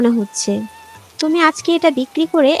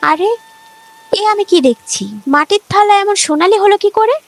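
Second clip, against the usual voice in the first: higher, louder cry of wonder; amazed; 6 semitones higher, 4 dB louder than usual. Acted. Couple finds the clay plate turned gold, amazed. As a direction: gasp it out in disbelief.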